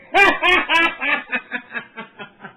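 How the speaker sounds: trailing laugh. Real, not acted. Still laughing at Liam.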